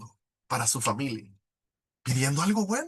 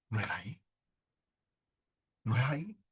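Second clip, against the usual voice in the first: much softer, resigned; quiet disappointment; 4 semitones lower, 9 dB quieter, faster than usual. Retold or imitated. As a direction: let it sink, almost to yourself. Disappointment after rejections. (resignado, en voz baja)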